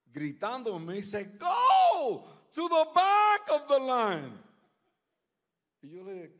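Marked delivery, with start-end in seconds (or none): 1.4-4.5 high, drawn-out imitated yell in English; shouting. Airport woman ordering him back in line, shouting. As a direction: holler it slowly, mimicking a yelling official. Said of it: "en voz alta, gritando".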